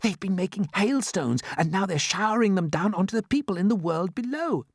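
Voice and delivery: high, eager explanation; excited. A narrator's reading. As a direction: let the discovery tumble out. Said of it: "whispered James excitedly".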